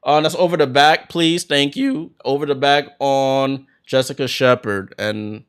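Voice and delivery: brisk live game call, names punched; play-by-play. Acted. Commentator calling the game for viewers, in lively play-by-play.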